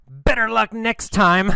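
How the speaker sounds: high, taunting yell to a rival; mock yell. Alan to Tom as he zooms past him, mock yell. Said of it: "He'll yell".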